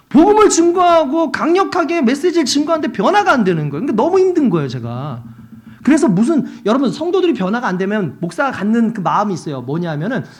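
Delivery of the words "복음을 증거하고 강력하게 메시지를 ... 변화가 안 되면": loud, high, driving sermon cry; preaching at full force. Acted. Pastor preaching loudly to the congregation about his struggle.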